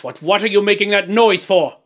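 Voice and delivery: loud, high, spluttering question; furious demand. A narrator's reading. King turning on the dwarf over a noise, furious. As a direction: round on him in anger. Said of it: "turning furiously to the dwarf".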